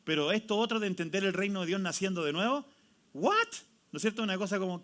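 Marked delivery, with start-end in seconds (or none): 3.1-3.5 high incredulous "what?"; a high, mocking, baffled "what?"; bewildered squeak. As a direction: squeak it out in mock disbelief. Mock bewildered reaction.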